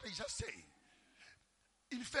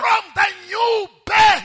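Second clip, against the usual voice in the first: shouted, slow and much higher; preaching at full force; 11 semitones higher, 23 dB louder, much slower than usual. Real, not acted. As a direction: thunder it out to the congregation.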